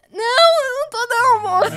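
high, loud mock wail; tearful denial. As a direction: deny it in a hammed-up crying voice. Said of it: "Você tá chorando pela Alice".

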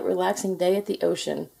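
plain reading voice

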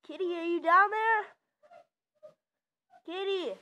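loud call into the distance; calling from far away. Real, not acted. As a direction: shout down the stairs for the cat. Calling the cat from afar.

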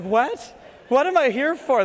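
high, loud, startled questioning; mock alarm. Dentist retelling a boy's reaction to slang, high mock-alarmed outburst. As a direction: imitate a shocked patient, pitch jumping up. Startled patient voiced in a story.

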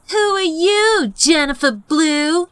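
put-on sing-song jeer at the new girl; mocking taunt. Hostile eyes given a voice.

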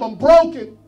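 firm loud cry; loud confession. Acted. Pastor voicing the broken sinner's prayer, loud. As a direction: state it loudly.

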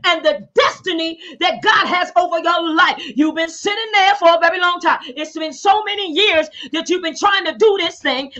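fervent, rising preacher's cadence; preacher urging listeners out of fear; preaching. Real, not acted. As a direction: drive the word home to the flock. Preaching to congregation.

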